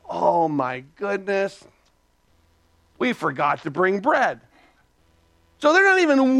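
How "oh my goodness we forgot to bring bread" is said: slow, low mock dismay. Disciples realize they forgot the bread, slow mock dismay. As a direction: imitate a sinking realization, dragged out.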